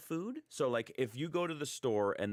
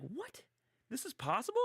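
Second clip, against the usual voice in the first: amazed. Retold or imitated. Husband reacting to his wife's cooking, much higher and amazed. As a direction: squeak it out in disbelief.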